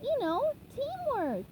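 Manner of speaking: cheerful SpongeBob. Acted. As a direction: chirp it happily. SpongeBob voiced in story.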